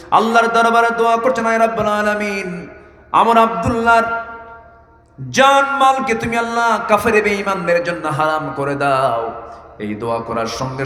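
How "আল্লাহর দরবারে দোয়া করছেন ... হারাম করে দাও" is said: high, chanted supplication; full-force prayer in preaching. Real, not acted. Preacher voicing the Prophet's prayer for Abdullah, chanted supplication. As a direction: call it out high as a sermon prayer.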